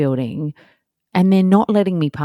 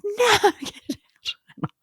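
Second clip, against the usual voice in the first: put-on much higher toddler "no, get out" with a laugh; 3 dB quieter, slower than usual. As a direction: play the stubborn two-year-old. Toddler refusing in retold scene.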